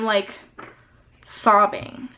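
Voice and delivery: normal talking voice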